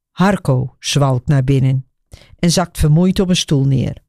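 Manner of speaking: ordinary delivery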